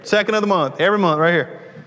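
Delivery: joking that he gives through Delta Community every month; mock announcer. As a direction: plug the bank like a hammed-up ad. Mock bank announcement, laughing.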